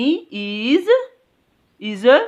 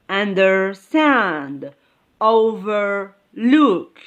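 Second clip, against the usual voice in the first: "understand" and "overlook" drawn out, stressing "stand" and "look"; slow examples, second half of "understand" and "overlook" stressed; stress on second part; slower than usual. Shown as a demonstration. Teacher giving examples of the stress rule, "understand" and "overlook" stressed on second part. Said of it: "on the second part. Example".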